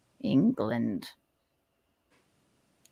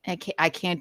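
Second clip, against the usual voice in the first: ordinary talk, nothing marked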